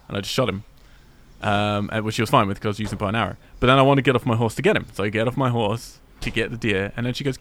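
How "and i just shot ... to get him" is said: normal talking voice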